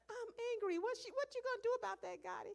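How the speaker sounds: put-on five-year-old complaining to Gotti about the toy, high and pouty; mock angry child. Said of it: "he was like".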